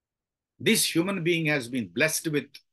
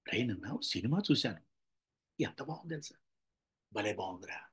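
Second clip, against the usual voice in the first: soft, low and understated; hushed, low film recommendation; low-key praise; 5 semitones lower, 10 dB quieter, faster than usual. Shown as a demonstration. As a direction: tell a friend quietly, voice held down. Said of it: "ఇది కూడా బానే ఉంది".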